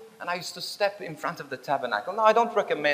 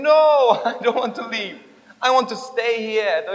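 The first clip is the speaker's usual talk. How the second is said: pleading refusal; 6 semitones higher, 7 dB louder than usual. Retold or imitated. Rob refuses to leave Jesus, pleading protest. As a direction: cry out the no, then plead in a raised voice.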